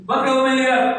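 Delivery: loud, high voice of God asking; voicing God. God questioning the prophet Samuel, loud and high. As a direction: boom out God's question. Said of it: "ng Diyos kay Prophet Samuel".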